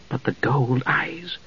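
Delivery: very soft, slow murmur; hushed doubt. Acted. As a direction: murmur it privately, slowly. Jenna privately voices doubt.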